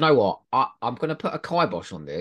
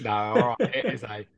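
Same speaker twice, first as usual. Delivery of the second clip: ordinary talk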